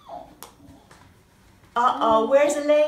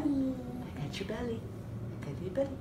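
hushed giggly tickle talk; soft laughing tickle; 6 semitones lower, 15 dB quieter, faster than usual. Real, not acted. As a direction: laugh softly while tickling. Tickling baby, laughing softly.

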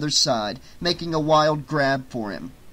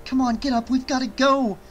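higher, urgent and out of breath; breathless; 10 semitones higher than usual. A narrator's reading. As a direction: pant it out as she pulls her friend up. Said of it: "she said breathlessly".

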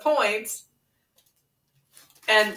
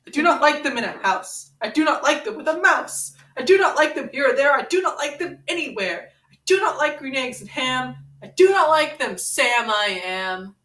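building, emphatic read-aloud, higher by the end; storybook reading; 4 semitones higher than usual. Acted. Reading the refusals to Ren, rising sing-song. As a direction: pound out each refusal like a bedtime story. Reads Dr. Seuss book aloud.

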